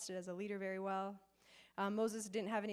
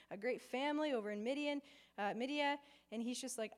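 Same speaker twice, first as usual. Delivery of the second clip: ordinary talk, nothing marked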